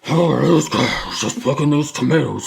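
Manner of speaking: hammed-up labouring man, puffing and straining; overweight neighbour. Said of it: "described as being overweight and like".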